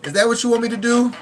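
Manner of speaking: high, loud, fast mock challenge; hammed-up quote; movie line impression. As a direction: fire it back like the film.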